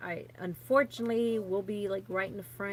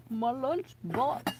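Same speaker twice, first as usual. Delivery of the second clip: slow, higher, drawn-out "lunchbox"; playful voice; 5 semitones higher, 3 dB louder, much slower than usual. Pretend. Woman hunting for her old lunchbox, playful drawl. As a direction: stretch it out in a playful put-on voice.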